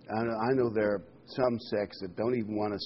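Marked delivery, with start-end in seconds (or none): none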